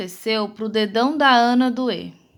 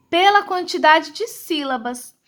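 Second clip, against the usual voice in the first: ordinary talk, nothing marked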